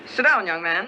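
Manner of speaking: stern father. Pretend. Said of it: "let's assume that you're interviewing our stern parent".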